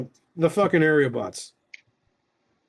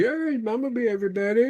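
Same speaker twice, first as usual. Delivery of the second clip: cooing greeting; 7 semitones higher than usual. Real, not acted. Greeting a cute toy.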